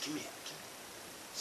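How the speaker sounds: whispered, breathy half-sentence; whispering. Retold or imitated. Talking to brother-in-law at night, in a whisper. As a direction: whisper so nobody wakes.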